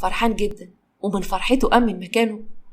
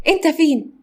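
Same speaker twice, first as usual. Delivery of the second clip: much higher, louder call through the house; 7 semitones higher, 4 dB louder than usual. Acted. Saleh calls out for his father, excited with news. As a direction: call out to find dad.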